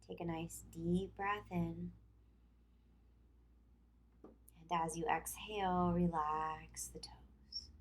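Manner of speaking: calm yoga instruction with long pauses; guided relaxation. Acted. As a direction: lead them through tensing and releasing, leaving room to breathe. Leads tense-and-release relaxation exercise.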